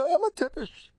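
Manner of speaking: hammed-up taunt; mock insult voice. Shown as a demonstration. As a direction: throw "I'm a tippish" in a high mocking tone.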